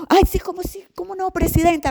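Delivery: high, sugary mock servility; fawning staff. Employees fawning over the president, a high, mock-servile voice. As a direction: ham up eager employees fawning.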